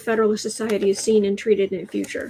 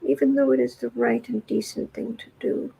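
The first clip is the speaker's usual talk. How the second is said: ordinary delivery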